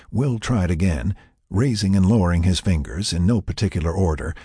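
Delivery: ordinary delivery, nothing marked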